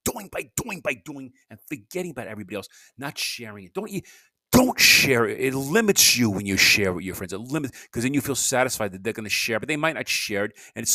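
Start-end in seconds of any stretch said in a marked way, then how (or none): 3.7-7.3 emphatic. Real, not acted. Urging listeners not to share.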